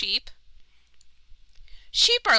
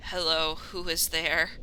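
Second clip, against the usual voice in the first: put-on low, slow, soft old voice; 5 semitones lower, 6 dB quieter, slower than usual. Old sheep character.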